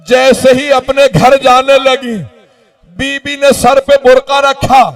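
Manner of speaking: high, loud narration mounting steadily; impassioned lament preaching. A narrator's reading. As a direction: build toward an anguished cry. Lament narration climbing to climax.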